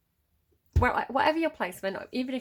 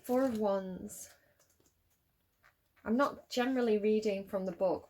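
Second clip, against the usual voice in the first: ordinary talk, nothing marked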